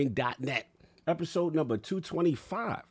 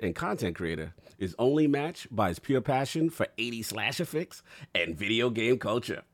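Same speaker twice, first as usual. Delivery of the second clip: usual speaking voice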